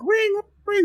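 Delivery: very high sung-out ring, ring like a trolley bell; imitated tram bell. Griping about Portland trams, imitating the bell very high.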